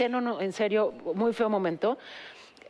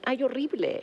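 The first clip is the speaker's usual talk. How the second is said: usual speaking voice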